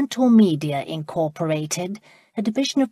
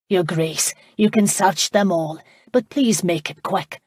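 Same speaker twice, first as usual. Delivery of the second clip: ordinary delivery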